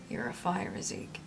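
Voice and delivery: hushed, airy delivery; quiet audiobook line; soft character dialogue. Acted. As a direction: say it softly, fading at the end. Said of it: "he said".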